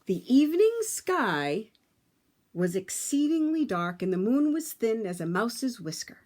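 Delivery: put-on lively read-aloud for children; storybook narration. A narrator's reading. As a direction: tell it like a bedtime story to a class.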